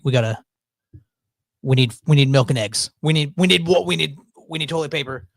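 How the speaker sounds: ordinary talk, nothing marked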